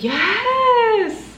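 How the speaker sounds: high, drawn-out squeal of joy; thrilled squeal. Real, not acted. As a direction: squeal it out, stretched long. Thrilled by Ghibli stickers.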